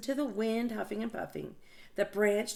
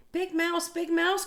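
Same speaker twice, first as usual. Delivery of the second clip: high mouse voice calling, louder; 10 semitones higher, 5 dB louder than usual. Acted. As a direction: call across the dark room. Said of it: "called Little Mouse".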